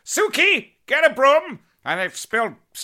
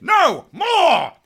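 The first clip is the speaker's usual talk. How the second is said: excited shout; 11 semitones higher, 7 dB louder than usual. Acted. Begging for more puns.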